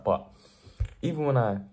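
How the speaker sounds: ordinary delivery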